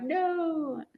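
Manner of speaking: a sudden high squeaked no; high mock no. To herself, stopping her valley girl talk: high squeaked no. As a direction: catch yourself and squeak no.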